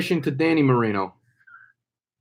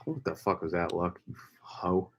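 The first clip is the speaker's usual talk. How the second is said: soft, low muttered curse; muttering; 7 semitones lower, 13 dB quieter than usual. Real, not acted. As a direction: grumble it under your breath. Muttering at the game clip.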